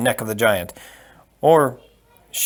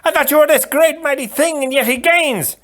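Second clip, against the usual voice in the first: very high, loud scolding; furious shout; 4 dB louder than usual. Acted. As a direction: yell it down at the giant. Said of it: "the Sicilian shouted".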